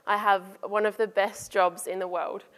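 ordinary delivery

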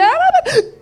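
high, sharp gasp of horror; gasp, caught in a blunder; horrified high gasp. Retold or imitated. Catches wrong name slip.

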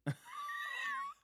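a sudden, very high breathy burst; squeaky high laugh. Real, not acted. Cracking up at Bill stalling mid-rant.

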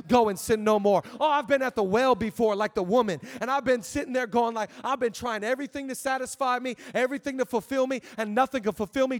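loud preaching building to a shout; full-force preaching. Real, not acted. Pastor rousing the church to praise God, full-force preaching.

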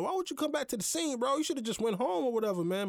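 high, fast, exasperated telling-off; incredulous scolding. Retold or imitated. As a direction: rattle it off high, like scolding a buddy. Retold scolding of a friend.

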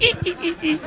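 tiny high, soft squeaks; cartoon sneaking noise. Comic imitating a man scampering to the toilet, soft high cartoon squeaks. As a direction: squeak like a cartoon character tiptoeing away. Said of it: "he scampered like a cartoon sneaking".

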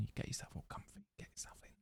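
fast breathy hypnotist whisper. Pretend. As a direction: lull him in a soft whisper. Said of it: "hypnotism voice".

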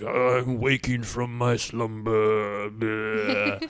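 slow, drawn-out monster voice; waking troll. Acted. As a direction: groan it out like a troll stirring from sleep. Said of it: "and the troll just, like, shaking".

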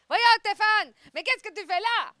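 high, loud mock ad character; TV ad acting. Co-host replaying a TV ad to tease the host, hammed-up acting. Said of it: "je m'en souviens, par contre, du texte".

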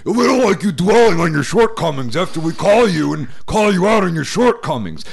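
high, loud parody of a nagging caller; exasperated put-on caller voice; mock complaining caller. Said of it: "I'll get another call about".

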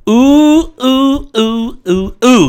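string of oohs sliding from high down; descending mock hooting. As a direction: hoot high, then step down. Hooting between character voices.

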